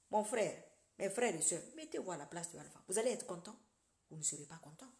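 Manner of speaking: normal talking voice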